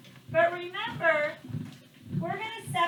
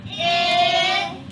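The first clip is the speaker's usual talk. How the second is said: loud sound tapping; 7 dB louder, much slower than usual. Shown as a demonstration. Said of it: "tap it out".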